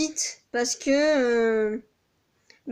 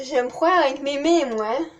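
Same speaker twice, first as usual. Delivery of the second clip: ordinary talk, nothing marked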